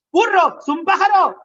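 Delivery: put-on high, loud exasperated parent snapping at a child; a parent losing patience shouts the answer; impatient scolding. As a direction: bark it at the pestering kid, fed up.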